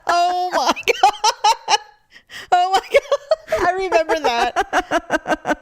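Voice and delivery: high, slow words through laughing; real laughter, almost crying; helpless laughter. Real, not acted. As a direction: gasp it out while cracking up. Laughing hard at owl story.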